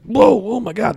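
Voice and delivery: hammed-up startled yelp; freaking out. Kids in the tree startled by the demon, mock panic. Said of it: "they're freaking out".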